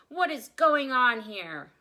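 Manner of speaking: loud furious demand; furious shout. Acted. Store manager catching the crowd, loud furious shout. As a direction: bellow it at the whole room in outrage. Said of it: "charged with furious shout".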